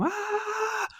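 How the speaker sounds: a high, thin mock scream; a hammed-up cry of pain, kept soft. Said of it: "he starts screaming".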